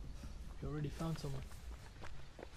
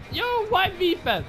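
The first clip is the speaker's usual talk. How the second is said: high, loud protest; real indignant shout; outraged shout; 11 dB louder than usual. Real, not acted. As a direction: yell at the unfairness. Killer targets him.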